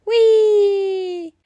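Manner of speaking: high, loud whee of delight; gleeful whee. Acted. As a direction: squeal down the hill. Children whizzing down a hill.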